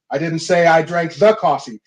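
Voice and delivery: "the" stressed as if it were that particular coffee; single out the coffee by hitting "the". Shown as a demonstration. Coach ending the demo with stress on "the". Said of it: "you can literally say it seven different ways".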